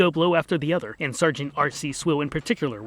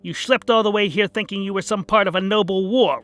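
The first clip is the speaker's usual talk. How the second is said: loud, high angry rally to the crowd; rallying speech; 5 semitones higher, 5 dB louder than usual. Acted. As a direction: rouse them to follow you to war. Rune addresses the crowd.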